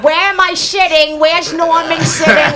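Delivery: high, loud mocking audience complaint; complaining show-goers. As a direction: imitate fussy ticket-holders demanding their seats. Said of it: "for these people".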